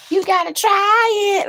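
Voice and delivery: high, slow squeaky catchphrase voice; imitating the dude. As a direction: stretch it out in a high voice. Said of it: "Like the dude says".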